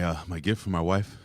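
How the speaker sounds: ordinary talk, nothing marked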